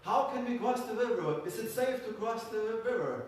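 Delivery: high shouted call; calling across the river. Acted. Schwabians shouting across the river, high and loud. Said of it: "they said".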